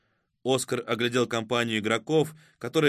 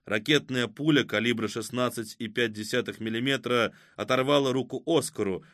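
ordinary delivery, nothing marked

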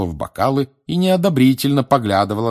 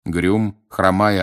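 ordinary delivery

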